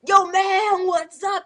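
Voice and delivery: loud, put-on homie hail; mock swaggering greeting. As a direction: lightly imitate a wannabe tough kid.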